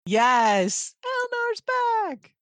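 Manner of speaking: high, drawn-out fan squeal; giddy cheer. Acted. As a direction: celebrate with a stretched, high-pitched cheer. Cheering a favorite character's return.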